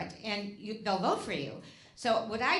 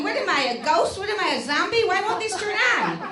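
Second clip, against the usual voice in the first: exasperated questions climbing higher and louder; mock exasperation; 5 semitones higher, 5 dB louder than usual. Comedian at automatic sinks that never turn on, mock exasperation. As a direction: play up baffled frustration at a useless machine. Baffled by sinks that won't work.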